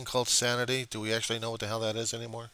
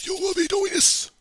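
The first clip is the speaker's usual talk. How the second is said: much higher, louder ranting commands; mock fanatic shout; 9 dB louder than usual. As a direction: yell it like a crazed prophet with his paper towel. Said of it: "Better yet".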